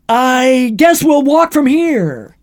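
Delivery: high, loud, slow mock delivery; corny, drawn-out trailer punchline; retold movie gag. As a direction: ham up the line, stretching every word. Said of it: "it's just them going".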